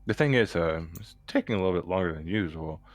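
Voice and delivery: ordinary talk, nothing marked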